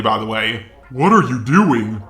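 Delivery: ordinary talk, nothing marked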